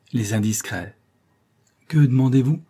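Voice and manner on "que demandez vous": demanding. A narrator's reading. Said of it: "fit-il d'une".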